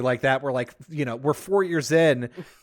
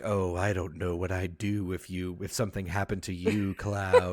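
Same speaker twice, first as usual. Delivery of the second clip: lower, softer, put-on doting villain; a low hushed voice, clingy and devoted; mock Sephiroth; 8 semitones lower, 4 dB quieter than usual. Villain fawning over the hero, low and soft. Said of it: "he's like".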